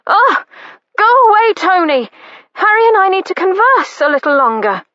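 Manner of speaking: loud, high and fast annoyed shout; lady shouting her brother away from the door; exasperated shouting. A narrator's reading. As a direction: shoo him away in a flustered rush. Sister shooing brother away.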